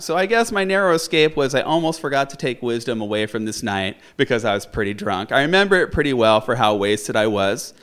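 ordinary delivery

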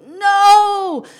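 a sudden cry to stop the spill; yelled no. Retold or imitated. Mom at toddler spilling yogurt, high loud shout. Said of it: "and I go".

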